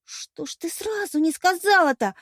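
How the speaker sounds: high-pitched reproach; indignant. A narrator's reading. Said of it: "возмутилась Вероника". (возмущённый упрёк)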